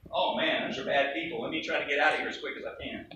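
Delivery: low, quick, quiet grumble; mock reluctant dismissal. Preacher imagining churchgoers avoiding bad people, mock reluctant mutter. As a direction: play wanting out, low and rushed.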